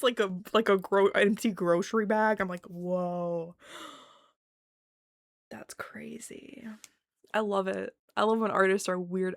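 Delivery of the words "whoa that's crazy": hushed, slow amazement; acted mind-blown murmur; stunned awe. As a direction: let it fade into a whisper. Acts out being stunned by art.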